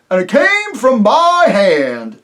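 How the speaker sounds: high, loud, hearty rustic voice with a chuckle; jovial rustic chief, laughing as he talks; chief of the invisible folk. Acted. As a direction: boom it out like a jolly simple village chief. Chief voice boasts.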